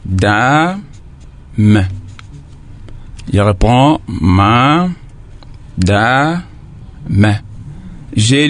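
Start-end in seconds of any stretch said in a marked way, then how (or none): 0.0-1.8 "da" and "me" separated by long pauses; syllable by syllable. Shown as a demonstration. Teacher splitting a word for pupils, syllable by syllable. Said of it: "j'ai divisé ces mots en combien de parties". 4.1-7.3 "ma", "da", "me" drawn out with long gaps; syllable by syllable. Shown as a demonstration. Teacher repeating a word for pupils, syllable by syllable. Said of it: "j'ai divisé ces mots en combien de parties".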